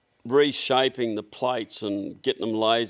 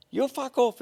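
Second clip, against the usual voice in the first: an offended caller snapping back, much higher; retold angry retort; 13 semitones higher than usual. Caller answering the rude phone clerk, mock angry and high. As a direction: toss it back at him, mock indignant. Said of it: "he said".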